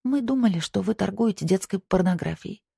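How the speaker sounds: high-pitched, meek female voice; humble confession. A narrator's reading. Young woman confesses her suspicion to the man, humbly. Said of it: "смиренно призналась Ясмин".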